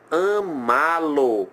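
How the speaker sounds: "amalo" very slow, each syllable stretched, stress on MA; sounded out slowly. Shown as a demonstration. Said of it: "Isso aqui vai gerar".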